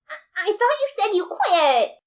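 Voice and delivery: high, loud stammered reproach; high nervous girlfriend voice; flustered accusing. A narrator's reading. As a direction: catch her out, stammering. Alphys voiced in story.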